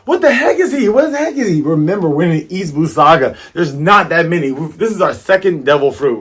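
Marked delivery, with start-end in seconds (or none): 0.1-1.6 high, raised crowd shock; mock astonishment. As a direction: exclaim it in high-pitched disbelief.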